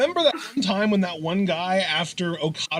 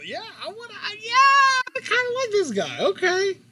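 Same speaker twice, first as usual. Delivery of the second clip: excited. Real, not acted. Excited about Hikuleo.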